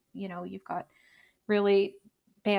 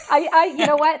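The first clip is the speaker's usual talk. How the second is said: laughing and higher, louder; 8 semitones higher, 11 dB louder than usual. Real, not acted. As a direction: spill it out through a laugh. Laughing, about to confess.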